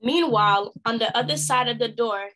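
ordinary delivery, nothing marked

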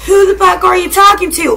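loud, high confrontational shout; telling off an enemy, shouted high; acted shout. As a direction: yell it at your enemy. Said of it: "you gonna be like".

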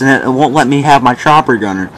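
normal talking voice

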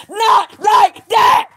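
high, loud and slow, spitting each word; defiant shout. Real, not acted. Ranting at viewers, a high slow furious shout. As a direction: refuse it with everything, slowly. Defiant rant about dying disgraced.

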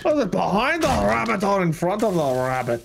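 hammed-up, terrible Scottish accent; bad Scottish accent. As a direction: put on a badly faked Scottish brogue.

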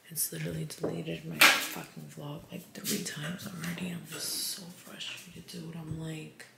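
tired, frustrated whisper at four in the morning; late-night whisper. Real, not acted. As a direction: whisper so nobody wakes up, worn out.

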